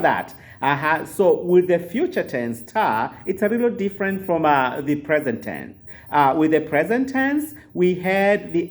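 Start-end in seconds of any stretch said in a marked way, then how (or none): none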